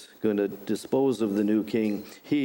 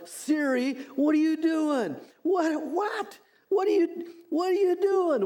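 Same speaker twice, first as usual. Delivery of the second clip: exasperated yell at Siri. Retold or imitated. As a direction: act out shouting at a wayward GPS. Preacher acting out yelling at GPS.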